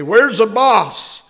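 put-on high, loud, slow child's demand; urgent boy. Tommy calling for the boss, high and loud. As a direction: call out eagerly like a boy. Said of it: "He asked the lady".

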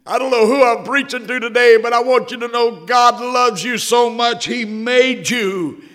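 high, loud, fervent preaching. Acted. Preacher urging the congregation of God's love, loud and high. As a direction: build the call to the congregation.

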